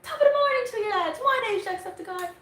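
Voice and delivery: high, loud Irish gamer greeting, full energy; Jacksepticeye. Retold or imitated. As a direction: belt it like a hyped Irish YouTuber opening his video. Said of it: "I'm from Jacksepticeye".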